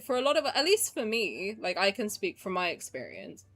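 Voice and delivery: ordinary talk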